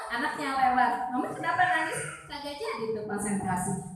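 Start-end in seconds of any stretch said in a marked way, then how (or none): 1.1-2.0 put-on higher, curious little-kid voice; a small child asking innocently; child's question. A child asks their crying mother why she is crying.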